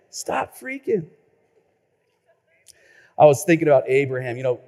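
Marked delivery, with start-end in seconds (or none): 0.1-1.1 softer, dropped echo; soft slogan. Shown as a demonstration. Preacher echoing the jingle line to himself, softer. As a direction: let it fall quietly. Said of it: "slogan repeated softly".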